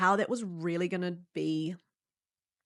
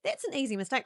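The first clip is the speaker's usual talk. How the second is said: reassuring mum; 7 semitones higher than usual. Retold or imitated. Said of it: "she goes".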